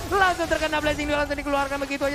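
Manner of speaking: loud, high teamfight shout; excited caster call. Acted. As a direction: hype up the double kill. (teriakan caster yang heboh)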